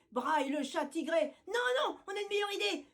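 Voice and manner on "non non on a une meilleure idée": high excited cry; yelling. Acted. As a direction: squeal it out eagerly. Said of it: "hurlent les minettes".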